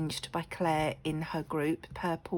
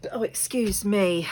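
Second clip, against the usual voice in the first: coughing; 3 semitones higher, 7 dB louder than usual. Real, not acted. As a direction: caught by a cough, louder. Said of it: "You can s-, tell I'm still not hundred percent".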